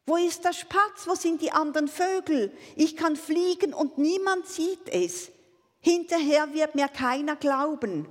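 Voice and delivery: high, loud complaint; disappointed calling out. A narrator's reading. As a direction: cry out in dismay that nobody is watching. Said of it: "rief er enttäuscht".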